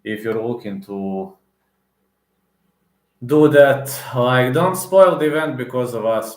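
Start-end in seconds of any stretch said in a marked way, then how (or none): 3.2-6.4 louder, raised, exasperated plea; angry plea. Real, not acted. Fan scolding the fighters who spoiled the event, loud and angry. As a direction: really let them have it for ruining it.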